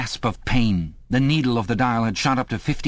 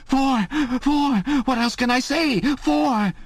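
very high, desperate wail; tortured, high-pitched outburst; agonized cry; slower than usual. A narrator's reading. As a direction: cry out in pain, pleading. Tortured prisoner crying out his answer.

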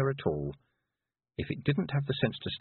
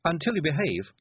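ordinary delivery, nothing marked

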